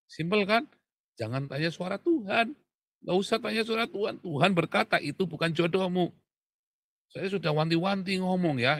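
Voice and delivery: high and loud, hammering the point home; preaching at full force. Acted. Pastor preaching to the congregation, high and loud.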